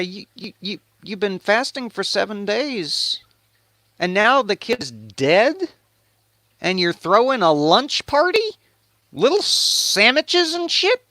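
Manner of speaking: high, halting disbelief; incredulous servants. Acted. Servants question David in disbelief.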